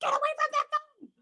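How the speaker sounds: final raging order; shrieked command. Acted. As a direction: scream it at her.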